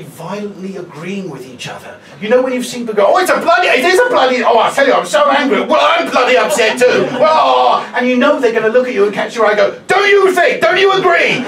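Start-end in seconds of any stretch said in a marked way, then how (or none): 3.1-7.1 loud, high indignant ranting, voicing the fleece-wearing passengers; working up a loud comic fury, higher and louder throughout; mock outraged ranting. Comedian imitating two passengers violently agreeing, loud indignant rant. 9.9-11.4 pushy passenger. Retold or imitated. Passengers seeking the comic's agreement.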